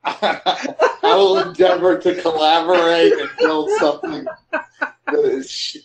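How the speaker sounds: words broken up by real laughter; chuckling through a mock-formal promise; laughing. Real, not acted. Guest to host, joking about collaborating, laughing.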